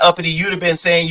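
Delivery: ordinary delivery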